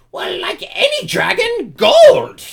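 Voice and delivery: proud, very high kobold voice, bursting loud on the last word; Kakarol. Acted. Puffed-up kobold leader naming his price, proud high voice. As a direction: squeak it proudly, then cry out the final word. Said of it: "he looks quite proud and happy".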